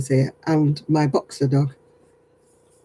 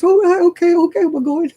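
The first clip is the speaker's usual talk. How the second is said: mock frightened retreat; 14 semitones higher, 5 dB louder than usual.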